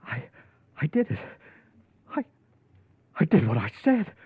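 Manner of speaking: halting, stammering disbelief rising high; stunned at what he's done; shocked. Acted. Killer staring at the body he struck down, stunned. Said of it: "Shock clean through at what you see".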